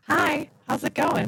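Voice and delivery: glitchy robotic audio; robotic, breaking-up line sound. Real, not acted. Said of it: "You sound a little robotic".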